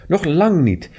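high, lively insistence; eager exclamation of denial; exclaimed protest. A narrator's reading. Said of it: "riep ze".

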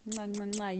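two quick kiss sounds into the mic; blown kisses. Real, not acted. Host blowing kisses to her live viewers, affectionate smacks.

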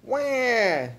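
high-pitched infant wail; baby ghost yell. A narrator's reading. Baby ghost yelling at Gus, high baby wail. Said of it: "yelled baby ghost".

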